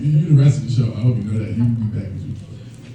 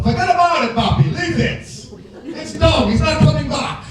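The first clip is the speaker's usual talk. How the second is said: mock yell; 10 semitones higher than usual. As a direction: shout it down the line, done with it. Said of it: "the Dominican guy on the other side of the phone yell".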